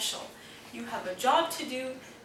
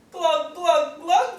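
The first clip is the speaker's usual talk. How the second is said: spluttering glug glug, higher; gurgled glug; 5 semitones higher, 6 dB louder, slower than usual. A narrator's reading. Hedgehog hauled from the pond, gurgling water. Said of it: "gurgled Hedgehog".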